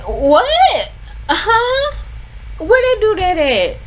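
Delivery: shocked high huh at the news; surprised disbelief. Real, not acted. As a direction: react in total disbelief. Reacting to co-maid of honor.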